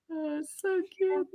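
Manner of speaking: ordinary delivery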